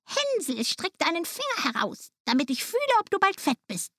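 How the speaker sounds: high, thin-volume witch call; old witch calling. A narrator's reading. Witch at Hänsel's cage, high-pitched calling. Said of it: "schlich die Alte zu dem Ställchen und rief".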